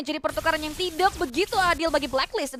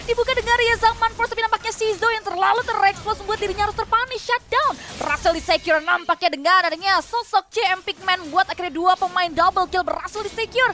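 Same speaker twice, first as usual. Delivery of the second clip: loud fast play-by-play; esports caster; 6 semitones higher, 6 dB louder than usual. Real, not acted. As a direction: rattle off the teamfight, loud.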